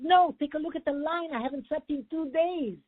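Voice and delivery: a hint of an exhausted clerk yelling; retold shout. Clerk shouting at the traveler about the long line, mild mock yell. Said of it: "she shouts and she said".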